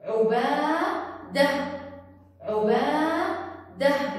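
عبادة drawn out in syllables, ending "-dah"; final h sound. Shown as a demonstration. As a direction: slowly sound out عبادة with no t at the end. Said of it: "ليش حرف الإه انتفض". (عبادة ببطء، آخرها هاء ساكنة)

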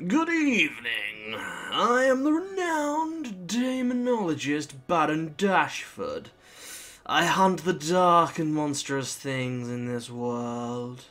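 slow, grandiose demonologist voice; renowned demonologist. Acted. Gamer reads Dashford's grand introduction aloud, slow and pompous. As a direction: intone it slowly, with drawn-out theatrical swells. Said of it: "I'm gonna read it then".

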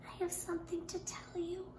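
a shy confession, very soft; very small voice. Acted. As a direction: say it small and nervous. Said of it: "said Ruthie in a very small voice".

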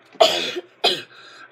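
loud, overdone hacking cough; fake cough. Kid faking a cough for the camera, loud hacking. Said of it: "That was a fake cough".

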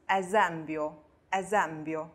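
esempio said as e-ZEM-pyo. Shown as a demonstration. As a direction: model esempio slowly with a buzzed z. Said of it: "那么发z的音".

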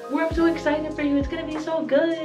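high-pitched gushing encouragement; squealed, high hype-up from a loved one; excited supporter. Retold or imitated. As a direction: cheer them on like an excited friend. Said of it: "somebody in my close circle say".

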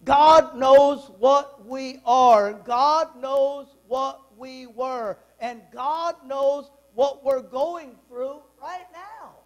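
slow, weighty sermon emphasis; preaching. Acted. Preacher assuring the congregation, slow and solemn. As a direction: lean on each phrase, slowly.

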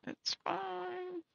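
"It's fine." lifted high and soft, forced brightness; sing-song it's fine. Real, not acted. Streamer faking cheer to chat, high sarcastic tone. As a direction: chirp "It's fine." to hide frustration.

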